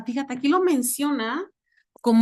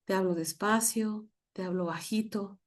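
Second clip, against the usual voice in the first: softer, hushed calm voice to show peace; soft and slow; 3 semitones lower, 8 dB quieter than usual. Shown as a demonstration. Pastor showing the class a calm voice, softly. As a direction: speak gently and softly to calm the air. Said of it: "Si yo cambio la voz".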